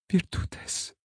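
soft whispered murmur; barely voiced reply. A narrator's reading. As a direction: breathe the word out quietly. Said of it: "murmura Aîné".

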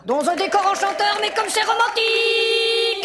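gushing. Acted. Host echoes dream.

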